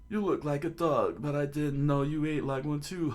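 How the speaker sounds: high, jovial ribbing; teasing shop owner. Acted. Owner teases old man over pet food, amused. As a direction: pitch it up, amused at your own joke. Acts owner joking and laughing.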